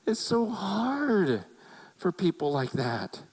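Jesus grieving as the rich ruler leaves; Jesus lamenting. Retold or imitated. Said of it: "He goes".

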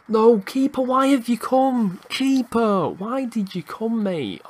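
high-pitched wail at the keeper; exasperated protest. Real, not acted. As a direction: plead in disbelief with a blundering keeper. Keeper blunders, goal conceded.

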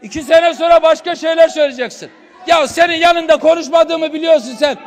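loud furious yelling; furious yell ordering silence; real angry shouting. Real, not acted. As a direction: shout the opponent down. Heated council row, shouting.

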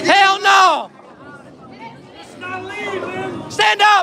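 urging shout. Real, not acted. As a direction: yell at the pins to stay standing. Urging pins to stay up.

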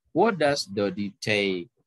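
slow question, pitch sinks on the final take; falling intonation. Shown as a demonstration. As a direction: drop the tune at the end of What does Dodi take. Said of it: "Turun, ya".

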